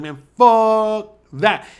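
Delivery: emphatic stubborn-dog voice; defiant dog. Retold or imitated. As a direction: drag out the curse defiantly. Voicing defiant dog again.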